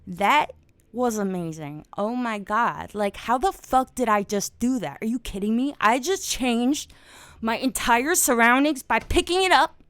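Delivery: loud, high amazed outbursts, swearing in wonder; excited. Pretend. Teacher pretending to be amazed, getting excited and loud. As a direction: burst out in mock astonishment at moving an object. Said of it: "I'm getting excited. I'm being loud".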